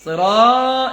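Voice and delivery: সিরা with "raa" swelled and falling; wrong, loosely drawn-out সিরা; overstretched mistake. Shown as a demonstration. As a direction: show the fault in সিরা, vowel dragged. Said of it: "এরকম যেন হয়".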